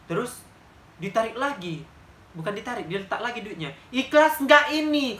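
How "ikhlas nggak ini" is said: higher, louder, slow and drawn-out threatening question; imitating the beggar woman's slow threatening challenge; menacing challenge. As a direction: loom over her, stretching every word. Beggar woman challenges once more.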